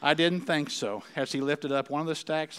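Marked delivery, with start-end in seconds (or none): 0.0-1.0 slow, deliberate reply; unhurried, measured delivery; said slowly. Acted. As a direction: take your time, coolly handing it back. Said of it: "and said slowly".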